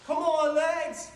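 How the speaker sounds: loud, high-pitched yell; screaming. Retold or imitated. Marathon runner screaming at own failing legs. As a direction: yell it high, urging them on. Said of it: "I'm screaming at my leg".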